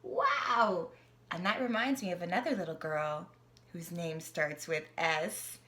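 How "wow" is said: ordinary delivery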